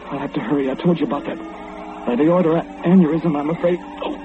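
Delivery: panicked. Acted. Heart failing as killers arrive.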